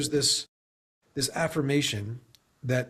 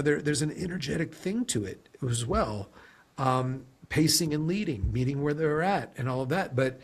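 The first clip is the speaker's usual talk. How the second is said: ordinary talk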